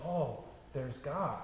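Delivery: soft, slow, offhand mumble; unimpressed murmur. Retold or imitated. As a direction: say it as if nothing special happened. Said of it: "none of us picks up a cup of wine and says".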